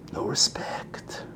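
slow hurt whisper; whispered no respect, slowly; whispered complaint. Acted. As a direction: breathe it out, deflated.

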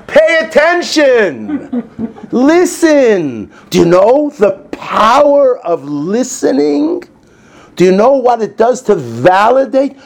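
loud, high, slow sermon climax; full-force preacher urging them to listen; fervent preaching. Acted. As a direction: drive it home to the congregation.

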